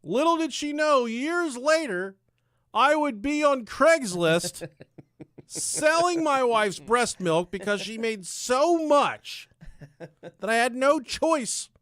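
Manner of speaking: high, loud storyteller's proclamation; hammed-up, projected narration; dramatic declaiming. Real, not acted. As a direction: proclaim it like an epic tale.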